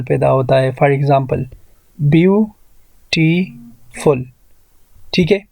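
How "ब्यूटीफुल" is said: ब्यूटीफुल broken into ब्यू, टी, फुल with long gaps; very slow ब्यूटीफुल, one syllable at a time. Shown as a demonstration. Teacher showing where syllables form, ब्यूटीफुल split slowly into three. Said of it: "आवाज़ change हो जाता है तो वहाँ पर syllable".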